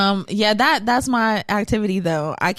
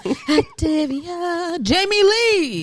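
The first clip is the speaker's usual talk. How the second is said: drawn-out ad voice; 9 semitones higher, much slower than usual. Retold or imitated. Imitates the Activia ad.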